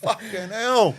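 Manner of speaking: high, loud outburst; appalled exclamation. Retold or imitated. Back-seat passenger watching them argue, appalled exclamation. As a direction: exclaim loudly in alarm.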